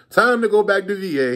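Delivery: high, loud happy exclaim; excited to leave. Retold or imitated. Dealer celebrating his money before going home, high and excited. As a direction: cheer about heading home. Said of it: "I'm like".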